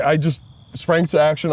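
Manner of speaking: ordinary delivery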